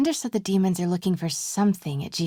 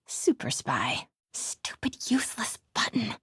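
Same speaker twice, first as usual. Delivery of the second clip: frustrated grumble; 4 dB quieter than usual. Acted. Frustrated muttering under breath.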